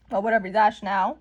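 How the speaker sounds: normal talking voice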